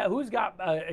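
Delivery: ordinary talk, nothing marked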